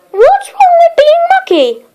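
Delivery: high, wide-eyed innocent question; a child playing sweet innocence, pitched very high; innocently. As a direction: ask as if you truly don't know. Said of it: "asked Little Miss Mucky innocently".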